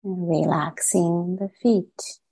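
calm, unhurried meditation guide with long pauses; gently measured meditation voice, settling phrase by phrase; guided meditation. Acted. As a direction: ease listeners into relaxing, leaving space after each phrase.

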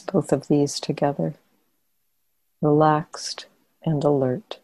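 calm meditation guidance with long pauses between phrases; guided meditation. Real, not acted. Teacher guiding meditators to soften and relax the body, guided meditation. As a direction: guide them gently into relaxation, leaving long silences.

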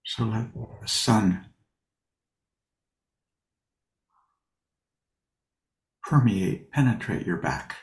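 unhurried, leave long pauses between short phrases; guided meditation. Real, not acted. Teacher settles sitters into posture and breath, guided meditation.

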